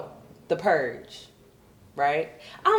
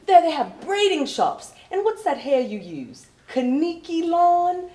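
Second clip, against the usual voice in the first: mock British woman, pitched up; British coworker; 10 semitones higher, 3 dB louder, slower than usual. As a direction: put on a posh-sounding English coworker, slow. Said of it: "She's like".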